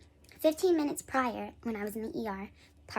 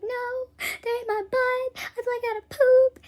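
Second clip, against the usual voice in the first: very high, loud drawn-out whine; hammed-up wail, insisting through the pain; mock wailing protest; 12 semitones higher, 9 dB louder than usual. Retold wailing protest in labor.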